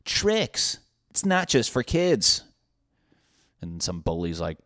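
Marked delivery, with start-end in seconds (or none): 0.1-2.4 much higher announcer-style slogan. Acted. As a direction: sell it like a cereal ad. Cereal commercial slogan.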